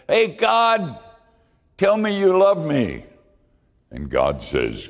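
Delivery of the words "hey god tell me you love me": high, loud giddy plea, dancing with joy; much higher, loud, like a giddy child; giddy Gideon. A narrator's reading. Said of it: "Gideon turns giddy".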